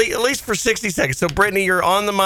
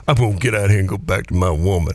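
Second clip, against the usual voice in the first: imitating the impression; 10 semitones lower than usual. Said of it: "did you hear what she said".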